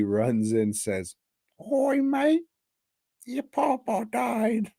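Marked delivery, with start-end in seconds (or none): 1.7-4.7 much higher falsetto lady voice; mock lady. A lady running in to tell the kid his papa died, in a comic falsetto. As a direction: squeak it like a comic Aussie lady.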